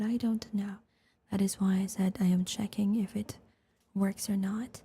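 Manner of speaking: soft breathy whisper into the mic; ASMR whisper. Real, not acted. ASMR streamer thanking viewers, whispering.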